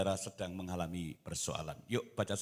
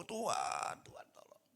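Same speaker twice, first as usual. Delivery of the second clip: high call dying to a whisper; mock crying out; 12 semitones higher than usual. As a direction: act out a hushed, high plea to God. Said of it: "Istrinya mestinya berseru". (seruan doa tiruan, meninggi lalu berbisik)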